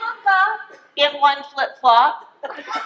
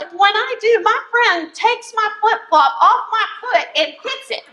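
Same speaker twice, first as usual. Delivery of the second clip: usual speaking voice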